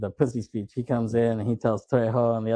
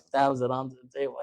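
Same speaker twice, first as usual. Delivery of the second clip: normal talking voice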